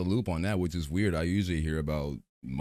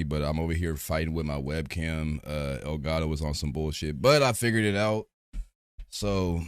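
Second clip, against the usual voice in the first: ordinary talk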